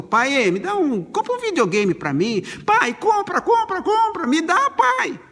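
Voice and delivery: put-on high, whiny kid pleading; childish begging. A son begging his father for a videogame, high whiny plea. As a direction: beg like a little kid for a toy. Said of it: "Seu filho chegou e falou".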